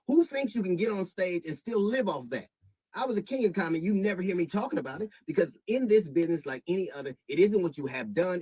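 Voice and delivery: real furious outburst; angry shout. Real, not acted. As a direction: let the anger boil over at her. Confronting Monique in public statement.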